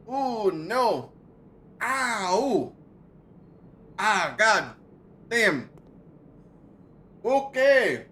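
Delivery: high, loud hoots of burning pain; pained yelping. Real, not acted. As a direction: yelp as the heat overwhelms you. Gasping and yelping at hot sauce.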